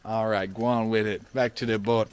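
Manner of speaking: playful mock Jamaican patois; Caribbean accent. Joking about heading back to the boat, mock Caribbean accent. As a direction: call it out in an imitated Caribbean lilt. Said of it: "That's a great accent".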